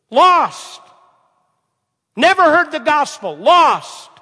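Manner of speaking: loud, high cry stretched slowly; preaching climax. Acted. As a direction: wail it out over the lost.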